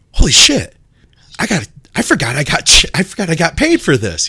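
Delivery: high, excited disbelief; retold amazed realization. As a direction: imitate someone stunned by good news. Retold stunned joy at forgotten check.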